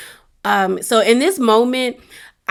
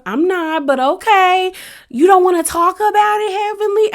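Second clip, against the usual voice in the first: imitating placating friend; 9 semitones higher than usual. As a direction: mimic a friend giving in, voice way up. Acts out Simone placating Heavenly.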